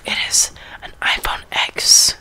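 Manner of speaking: hushed phone show and tell; whispering. Real, not acted.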